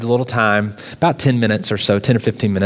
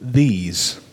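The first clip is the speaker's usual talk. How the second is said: "these" set apart and weighted; key word. Shown as a demonstration. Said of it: "the key word in this question is".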